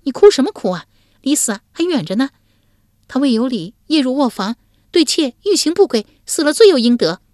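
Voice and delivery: high, scolding wife voice; scolding young woman. A narrator's reading. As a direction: brush off his tears sharply.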